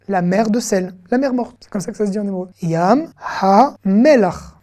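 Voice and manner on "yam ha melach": "Yam ha melach" slowly, each word held apart; slow sounding-out. Shown as a demonstration. Teacher reading out the Dead Sea in Hebrew, slow sounding-out. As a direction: stretch and separate "Yam", "ha", "melach". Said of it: "on va faire consonne plus voyelle".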